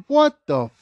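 high, loud shocked cry; outraged disbelief. Retold or imitated. At the party seeing the matching outfits, loud shocked yell. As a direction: yelp it in disbelief. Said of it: "I'm like".

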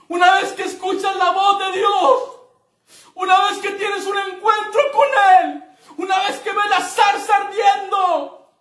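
high, loud shouted preaching; preacher shouting praise at the climax; preaching at full force. Real, not acted. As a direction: lift the church to its peak. Climax of the sermon.

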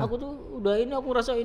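high-pitched retold tearful confession; imitated crying. Retelling his call to his friends after leaving, imitated crying. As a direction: act out crying down the phone. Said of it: "Nangis kamu? Nangis, nangis".

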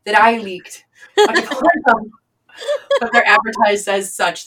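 ordinary delivery

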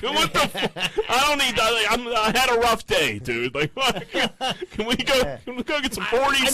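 high-pitched, worked-up pleading; exasperated kid. Retold or imitated. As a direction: protest and beg like a frazzled boy. Kid protesting after rough day.

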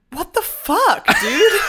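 high, incredulous cry of disbelief; shocked outburst. Retold or imitated. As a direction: squeal it like you can't believe what you heard. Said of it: "And Milo was like".

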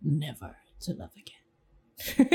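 low, slow monotone whisper. Shown as a demonstration. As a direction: whisper it flat and slow, deadpan. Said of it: "Is it monotonous enough?".

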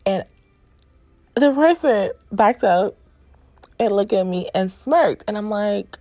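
ordinary delivery

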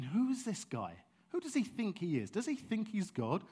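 huffy scoffing religious teachers; indignant scribes. Retold or imitated. Scribes muttering about Jesus, mock-indignant. Scribes voiced in retold scene.

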